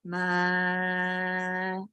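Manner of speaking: "Ma" held low, soft and level; long, steady low "Ma"; low pitch. Shown as a demonstration. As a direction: sustain "Ma" on a quiet low note. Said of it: "low pitch".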